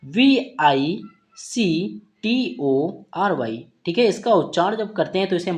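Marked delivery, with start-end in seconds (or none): 0.0-3.6 very slow, letter by letter; spelling out letters. Shown as a demonstration. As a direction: spell each letter out slowly with pauses. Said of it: "next word यहाँ पर हमारा देखने को क्या मिलेगा". (धीरे-धीरे अक्षर बोलते हुए)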